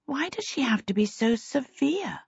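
high, hushed whisper; plaintive whispered thought; inner thought. A narrator's reading. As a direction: whisper a private complaint to yourself. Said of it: "she thought".

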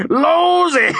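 roaring laugh around a high shout; explosive laughter. Acted. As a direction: burst out laughing, loud and high. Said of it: "An explosive laugh burst out".

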